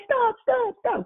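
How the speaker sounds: very high, squealed mock plea; kid's protest. Child with arm bent back by older brothers, mock protest. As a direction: squeal it like a pinned younger sibling. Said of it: "you'd be like".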